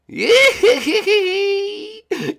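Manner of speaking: high, loud villain cackle; evil laugh. Acted. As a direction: cackle over the trapped victim. Villain laughs after capture.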